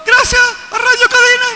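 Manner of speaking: very high, loud metallic call. Shown as a demonstration. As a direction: call it out across the mountains. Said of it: "El eco de una montaña".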